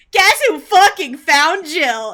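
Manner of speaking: loud, slow and smug; loud slow gloating quote. Retold or imitated. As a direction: shout it out slowly, gleeful. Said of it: "he's like".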